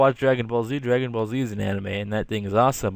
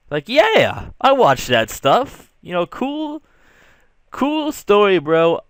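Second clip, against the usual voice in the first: much higher and louder, an overeager cool-bro act; hammed-up cool guy; 8 semitones higher, 4 dB louder, slower than usual. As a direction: oversell the enthusiasm with a thumbs up. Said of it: "That's me with a thumbs up".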